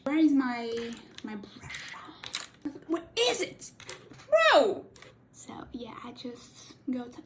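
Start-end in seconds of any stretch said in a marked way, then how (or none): 2.9-4.8 high, loud exasperated bro; exasperated shout. Real, not acted. Can't find her brush. 5.3-7.3 whispered aside. Real, not acted. Quiet demo aside.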